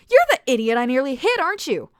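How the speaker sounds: high, loud accusation; angry accusation. A narrator's reading. Alice accuses Oswin of causing her crash, angry. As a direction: accuse him outright. Accusing finger, recognizes him.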